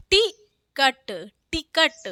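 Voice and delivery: टिकट split as quick "ti", pause, "kat"; slow syllable-by-syllable टिकट with brief first vowel; short i. Shown as a demonstration. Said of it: "टा पे छोटी लगी है".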